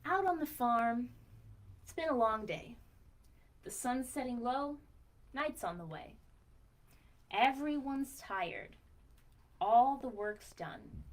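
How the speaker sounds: put-on slow, gentle read-aloud for children; storybook reading. A narrator's reading. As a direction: read it to little ones, unhurried. Reads picture book aloud.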